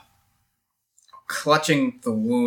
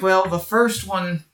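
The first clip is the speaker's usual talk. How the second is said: cocky hero quip; 7 semitones higher than usual. Acted. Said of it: "and I'm like".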